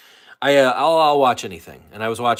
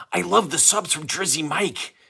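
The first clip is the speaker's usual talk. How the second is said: a higher, lightly voiced ad-man line; a self-admitted failed celebrity imitation; Danny DeVito attempt; 8 semitones higher than usual. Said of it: "I can't do my Danny DeVito".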